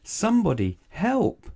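high, loud cry for help; desperate cry. A narrator's reading. Little Miss Lucky crying for help while carried skyward, loud and high. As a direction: call out, lost in the wind. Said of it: "she cried".

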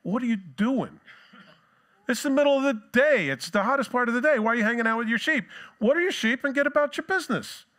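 high, loud, exasperated scolding; bossing people around. Retold or imitated. Jacob scolding the shepherds for hanging about, high and bossy. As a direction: boss the idle shepherds around. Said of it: "starts bossing people around".

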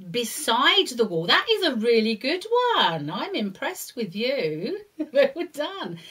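loud, high praise; excited praise. Real, not acted. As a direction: cheer them on warmly. Praising child.

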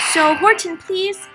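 high little plea; small, high imploring voice; pleading. A narrator's reading. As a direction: plead up to a big friend. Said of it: "pleaded the voice".

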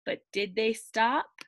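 plain reading voice

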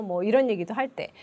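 usual speaking voice

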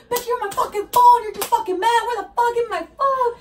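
high-pitched, wailing mock rant; imitated yelling. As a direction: imitate an angry friend, voice way up. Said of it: "this bitch fucking starts yelling at me".

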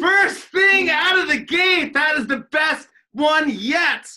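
shouts in delight, high; cheering a win; excited shout. Real, not acted. Cheering the correct answer.

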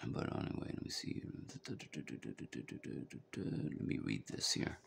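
whispered aside while reading; barely audible, reading to himself; muttering to himself. Real, not acted. Reading notes quietly to himself.

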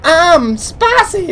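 very high, silly commercial voice; goofy voice. Retold or imitated. Imitating Joel McHale in a Burger King commercial, high goofy voice. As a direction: imitate an absurdly high-pitched ad catchphrase. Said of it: "That's the goofiest voice that I've ever heard".

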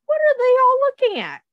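put-on high, fast, eager question to the kids; excited question. Reader to the children, high and eager. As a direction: ask it like it's a big mystery.

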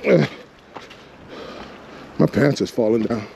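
usual speaking voice

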